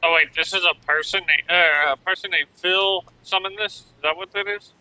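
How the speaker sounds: high, loud, quick puzzling; excited confusion. Real, not acted. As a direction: rush the question out.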